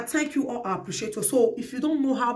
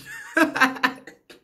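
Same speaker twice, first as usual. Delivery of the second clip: high laugh; 5 dB louder than usual. Real, not acted. Laughs at grandmother witch remark.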